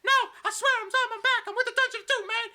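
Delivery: loud, very high pleading squeal; hammed-up tearful falsetto; Jimmy Hart crying. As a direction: beg frantically in a squeaky wail. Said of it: "Jimmy Hart is crying".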